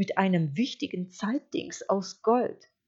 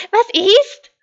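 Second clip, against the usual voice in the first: very high, loud sheep cry; sharp, piercing voice; 18 dB louder than usual. A narrator's reading. Sheep challenging the stranger at the door, sharp voice. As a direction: call it out high and pointed. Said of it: "rief Schaf mit spitzer Stimme".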